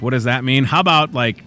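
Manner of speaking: normal talking voice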